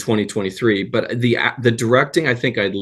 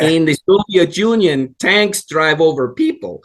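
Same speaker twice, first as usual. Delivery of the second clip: put-on Yakov Smirnoff Russian accent; 6 semitones higher than usual. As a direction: land the punchline in a hammed-up Russian accent. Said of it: "this is what he sounded like".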